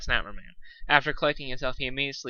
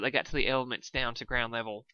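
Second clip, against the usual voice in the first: ordinary delivery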